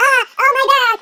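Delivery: hammed-up agony; wounded groaning. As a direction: cry out as if stabbed. Wounded beast crying out.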